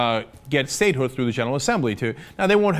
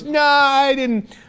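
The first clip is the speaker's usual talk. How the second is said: mocking dismissal; 11 semitones higher, 4 dB louder, much slower than usual. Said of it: "Israel goes".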